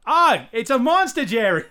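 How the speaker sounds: high, loud, hammed-up Boston accent; Boston accent outcry. Giving a Boston key phrase, shouted mock-alarm Boston accent. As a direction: yell it in alarm, Boston R's dropped. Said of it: "whatever that guy's name was".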